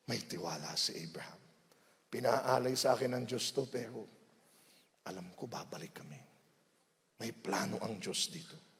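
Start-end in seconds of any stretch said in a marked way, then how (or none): 2.1-8.7 hushed trust. Acted. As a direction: speak Abraham's faith barely aloud. Abraham's inner words, hushed.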